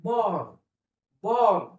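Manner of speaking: बॉर्न with a light, unrolled र; not harsh, gentle r in बॉर्न; soft r. Shown as a demonstration. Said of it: "एकदम harsh असा उच्चार करायचा नाही".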